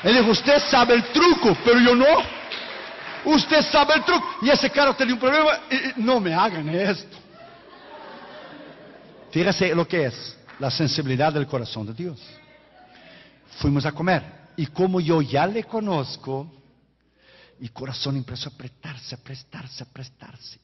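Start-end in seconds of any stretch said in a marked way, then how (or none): 0.3-7.2 mock retort. Pastor retorts about the car. 17.6-20.6 fading whisper. Acted. Heart tightening, voice fading.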